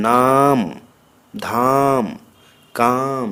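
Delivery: long drawn-out আ in নাম, ধাম, কাম; long vowel. Shown as a demonstration. Teacher showing long আ in one-syllable words, drawn out in নাম, ধাম, কাম. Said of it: "আ দীর্ঘ".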